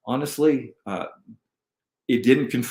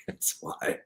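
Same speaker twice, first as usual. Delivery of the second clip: laughing; 10 dB quieter than usual. Real, not acted. Laughs at overloaded computer.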